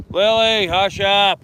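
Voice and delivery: loud, sharp call to the dog; scolding shout. Real, not acted.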